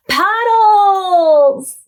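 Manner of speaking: delighted discovery; excited gasp. A narrator's reading. Lola's idea bursts out.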